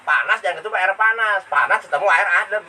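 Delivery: normal talking voice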